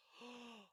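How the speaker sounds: sharp high gasp of hurt; shocked gasp. Retold or imitated. Wife overhearing herself called crazy, sharp gasp.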